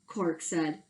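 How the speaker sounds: plain reading voice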